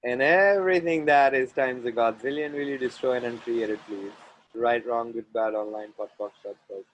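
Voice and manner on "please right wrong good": ordinary delivery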